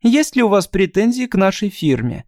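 put-on high chirping girl voice; chirpy thin voice. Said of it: "прощебетал тоненький голосок".

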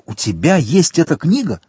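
high, excited question; astonished exclamation. A narrator's reading. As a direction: ask in amazement, pitch leaping up. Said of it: "воскликнул Монте-Кристо".

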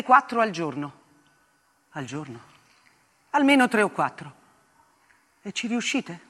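narrazione lenta e grave, su musica; racconto drammatico. Acted. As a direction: racconta il dialogo col soldato, cupa. (voce narrante grave e contenuta)

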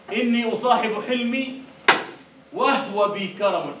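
slow proud declaiming; poem recital. Acted. Teacher reciting Mutanabbi to the class, slow and proud. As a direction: recite it with dignity.

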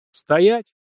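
high, loud command to halt; barked order. A narrator's reading. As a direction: order someone to freeze. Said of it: "велел он".

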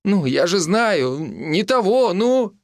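high, flustered hedging; embarrassed insistence. A narrator's reading. Mitka cannot name his complaint, embarrassed. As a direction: fumble for words, pitch climbing. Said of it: "густо покраснел Митяй".

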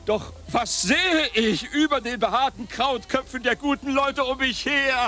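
high, lofty proclaiming; theatrical exclamation. Acted. Gabriel declaiming over the tourists' heads, high and grand. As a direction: lift it up like a stage orator.

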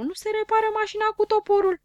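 can't believe they'd fix it with an axe; exasperated disbelief. Acted. (uimire indignată, mult mai sus și repede)

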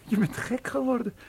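squeaky high incredulous exclamation after a laugh. Acted. Paul laughing at Carla's wish, exclaiming high and incredulous. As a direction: toss it off in amused disbelief.

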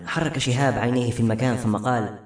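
ordinary delivery

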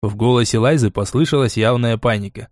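plain reading voice

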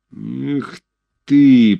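drawn-out scornful sigh; contemptuous drawl. A narrator's reading. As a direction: sneer it, dragging the words. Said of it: "протянул Грузов презрительно".

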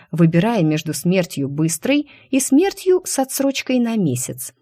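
plain reading voice

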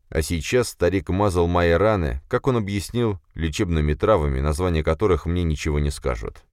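ordinary delivery, nothing marked

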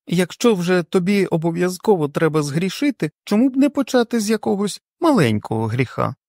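scolding wife. A narrator's reading. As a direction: tell him off firmly.

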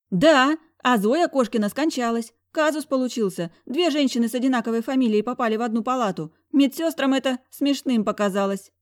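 high-pitched jittery doctor; doctor explaining the mix-up with a nervous smile. A narrator's reading. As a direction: rush through it nervously. Doctor voiced higher, nervous.

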